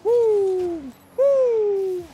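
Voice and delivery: high whooping cheers; high woo cheer. Real, not acted. Gamer whooping at teammates, high cheer.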